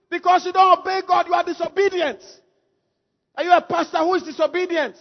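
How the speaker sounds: high, loud preaching; forceful preaching. Acted. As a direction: drive the rebuke home to the congregation.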